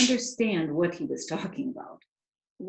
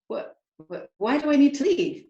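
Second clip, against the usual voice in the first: startled protest; 7 semitones higher, 4 dB louder than usual. A narrator's reading. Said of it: "she said".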